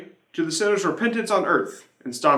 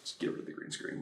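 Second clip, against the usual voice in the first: under-the-breath joke to the crew; hushed, conspiratorial aside; whispered aside; 18 dB quieter, faster than usual. Real, not acted. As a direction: whisper it off-mic. Aside about the green screen.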